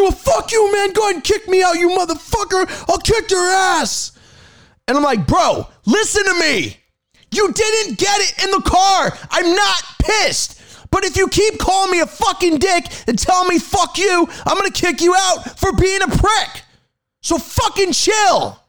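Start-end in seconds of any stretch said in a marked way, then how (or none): 0.0-4.1 high-pitched mock rant; acted full-on shouting, voice high; angry yelling. Retold passenger threatening the driver. 5.3-18.6 exasperated retold tirade, each word stretched; going off. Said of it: "I went off on him".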